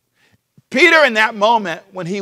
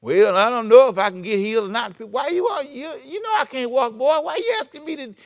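wimpy; 5 semitones higher than usual. Retold or imitated. A doubter asking God about healing, wimpy and timid. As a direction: whine it like you doubt you'll ever heal. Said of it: "we sound so wimpy all the time".

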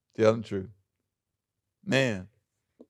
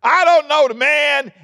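very loud, much higher frantic denial; yelled denial; 12 semitones higher, 9 dB louder than usual. Acted. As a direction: scream the denial out. Peter's third denial, yelled.

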